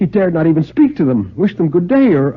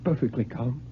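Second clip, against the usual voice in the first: feigned calm; 15 dB quieter than usual. A narrator's reading. Coward feigns calm before seconds.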